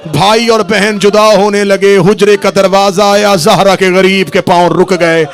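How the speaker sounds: impassioned mourning sermon at full force; full-force preaching. Acted. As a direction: preach it to the hall, voice climbing into a lament. Loud chanted mourning narration.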